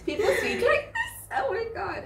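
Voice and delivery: put-on high, laughing disbelief at the accent; retelling the shock of hearing the accent, laughing. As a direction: squeal it out in amused shock. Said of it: "Okie accent".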